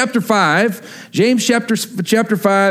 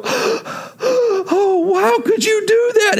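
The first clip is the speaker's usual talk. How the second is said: very high-pitched mock mom voice; hammed-up, high dismayed mother; upset mom; 14 semitones higher, much slower than usual. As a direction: wail it like a wounded wife. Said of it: "you hear my mom go".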